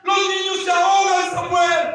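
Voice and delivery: high, loud cry for help; acted, urgent scream of his name; wife shouting. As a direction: call out frantically to a husband. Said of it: "su esposa le gritaba".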